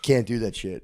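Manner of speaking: usual speaking voice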